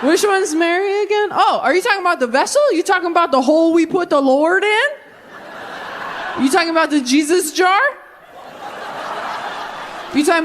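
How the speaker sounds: high, rising mock-puzzled questions; clueless men. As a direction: play an oblivious man checking who is meant. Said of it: "all the men were like".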